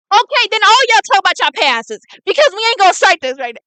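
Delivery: loud, high, heated outburst; getting mad. Real, not acted. Player telling the others to share their pasts, mad outburst. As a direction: fire it off, fed up and loud. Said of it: "I get mad too easily".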